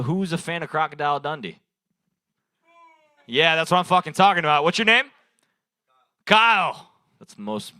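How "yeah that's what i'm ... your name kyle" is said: loud, pumped call to the crowd; hyped shout. Real, not acted. Comic yelling at Kyle in the crowd, pumped shout. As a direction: yell it out like a hype man.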